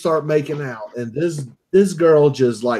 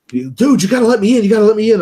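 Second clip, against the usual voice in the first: high, loud plea imitating a panicked friend; mock yell, begging to be let in; retold frantic yelling; 7 semitones higher, 6 dB louder, faster than usual. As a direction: act out a buddy yelling at the door. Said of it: "screaming bloody murder".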